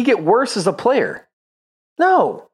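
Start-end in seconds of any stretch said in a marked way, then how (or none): 2.0-2.5 sharp high no; emphatic no. Real, not acted. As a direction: cut the doubt off hard. Answers own question emphatically.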